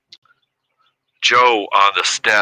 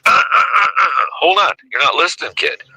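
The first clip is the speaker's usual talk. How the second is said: imitated interrupting sponsor; 10 semitones higher than usual. Sponsor stops the newcomer's protest about the job, imitated scolding interruption. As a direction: mimic a sponsor cutting off an objection.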